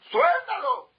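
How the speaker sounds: very high, loud yelled command; the rabbi yells at the young man with full force; full shout. Retold or imitated. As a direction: scream the order at him. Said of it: "Y le gritó tanto".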